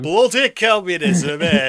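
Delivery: a northern English comrade complaining about communism; northern English accent. Retold or imitated. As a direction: moan it like a northern English bloke down the pub. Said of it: "northern people walking around going like".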